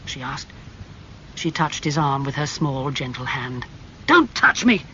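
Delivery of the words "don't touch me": loud, high burst of anger; angry shout. A narrator's reading. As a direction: shout it sharply to repel her. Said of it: "he shouted angrily".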